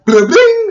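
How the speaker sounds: high ringing "bling"; imitated notification chime. Telling viewers a Snapchat came in, imitated chime. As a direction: mimic a phone alert. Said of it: "or whatever sound Snapchat makes".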